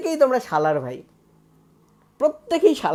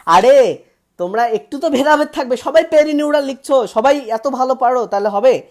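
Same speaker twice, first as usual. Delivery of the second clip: higher, louder mock outrage that all got it right; 5 semitones higher, 6 dB louder than usual. Real, not acted. Teacher playfully scolding students for all answering right, mock outrage. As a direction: playfully scold the class for being too good.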